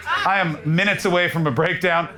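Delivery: ordinary talk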